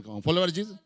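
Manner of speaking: ordinary talk, nothing marked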